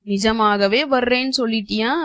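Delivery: usual speaking voice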